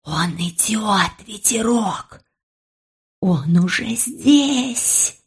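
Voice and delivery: slow, soft sinister whisper; ominous whisper. Acted. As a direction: whisper a creepy warning. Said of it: "зловещим шёпотом сообщила".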